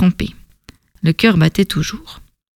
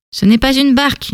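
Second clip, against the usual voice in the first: usual speaking voice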